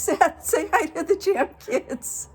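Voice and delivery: high, laughing introduction. Real, not acted. Host still laughing while prompting her friend to greet the kids. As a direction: giggle through the hand-off.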